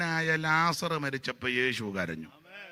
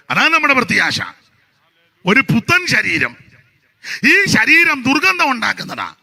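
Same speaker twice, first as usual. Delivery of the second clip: loud, high, fervent sermon delivery; full-force preaching; 7 semitones higher, 12 dB louder than usual. Acted. Preacher thundering to the congregation with fervent loud preaching. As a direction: preach it out to the whole church.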